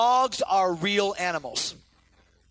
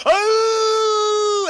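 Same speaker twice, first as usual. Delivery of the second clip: long high loud husky howl; 13 semitones higher, 10 dB louder than usual. Retold or imitated. Son howling at the dog, long high loud howl. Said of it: "He'll do the exact same tone".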